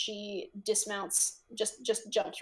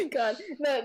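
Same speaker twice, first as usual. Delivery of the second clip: ordinary talk, nothing marked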